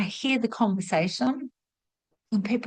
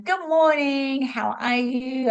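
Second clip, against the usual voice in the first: higher, slow, sing-song good morning; cheery greeting; 5 semitones higher, slower than usual. Retold or imitated. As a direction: greet the office brightly and drawn out. Cheery greeting walking into work.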